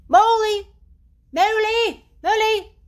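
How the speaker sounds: high, loud repeated calls; calling out. A narrator's reading. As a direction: call his friend across the house. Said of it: "Then he called".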